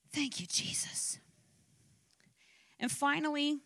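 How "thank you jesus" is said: soft breathy thanks to Jesus; whispered prayer. Real, not acted. Pastor quietly thanking Jesus after the climax, in a whisper.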